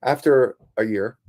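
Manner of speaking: usual speaking voice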